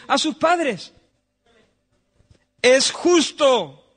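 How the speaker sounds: loud, high preaching at full force; fiery preaching. Acted. Preacher insisting to the church that obeying parents is right. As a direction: hammer each point home to the pews.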